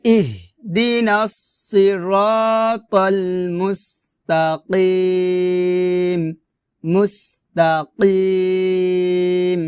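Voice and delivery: "ইহদিনাস সিরাতাল মুস্তাকিম" with heavy sad and throaty qaf; tajweed demo of "ইহদিনাস সিরাতাল মুস্তাকিম", slow and sustained; slow Quran recitation. Shown as a demonstration. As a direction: stretch the long vowels of "সিরাতাল" and "মুস্তাকিম". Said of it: "আবার".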